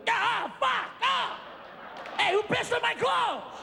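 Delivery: high, slow shouted outrage; outraged discovery. Retold or imitated. Waking to find soaked clothes, high outraged shout. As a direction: howl at the ruined clothes.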